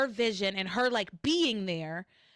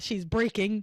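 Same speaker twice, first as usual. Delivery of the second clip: ordinary talk, nothing marked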